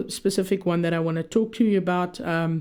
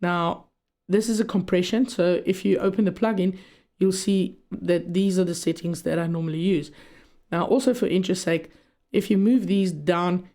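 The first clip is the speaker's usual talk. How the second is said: normal talking voice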